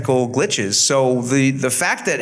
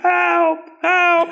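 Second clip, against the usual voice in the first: high thin cry for help played from a tape; distant recorded help call; Josh's boombox voice. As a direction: mimic a tinny recorded voice calling help. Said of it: "Josh's boombox voice going".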